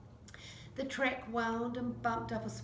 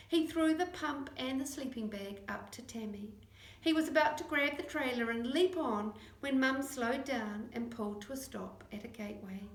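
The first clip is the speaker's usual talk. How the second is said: plain reading voice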